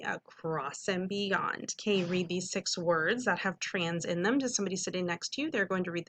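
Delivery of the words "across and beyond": Across and beyond repeated slowly and softer; teacher saying it together with students; slow choral echo. Shown as a demonstration. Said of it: "do that with me".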